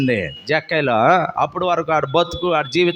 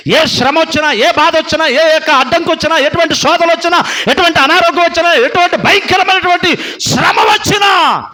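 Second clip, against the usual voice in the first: higher, louder preaching at full force; fiery preaching; 12 semitones higher, 8 dB louder than usual. Real, not acted. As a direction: exhort the congregation with fire. Preaching build-up.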